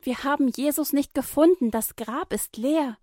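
high, loud excited call. A narrator's reading. The women calling to the disciples, loud. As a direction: burst in with the news. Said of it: "Sie rufen".

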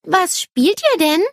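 put-on high, curious little-girl question. A narrator's reading. Nele asking the boys about their game, curious. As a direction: lift the voice high, wanting to know. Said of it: "fragt Nele neugierig".

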